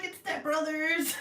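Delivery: high giggly recalled line; laughing through the words; laughing quote. Retold or imitated. Telling viewers how she laughed at the fountain, giggling high.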